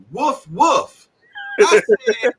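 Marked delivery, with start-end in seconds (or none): 0.1-1.1 high "woof, woof" imitating a dog; dog bark imitation. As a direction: spell it out with two barks. Imitates a dog to explain Doug.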